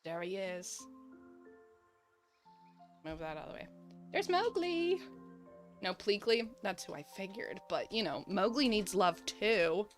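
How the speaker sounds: usual speaking voice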